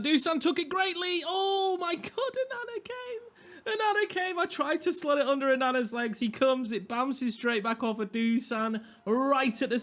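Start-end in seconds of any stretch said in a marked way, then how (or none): 0.0-6.2 high, stretched cry of excitement; excited goal shout. Real, not acted. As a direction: celebrate the goal in a high squeal. Scores a goal, excited commentary.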